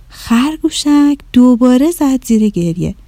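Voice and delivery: usual speaking voice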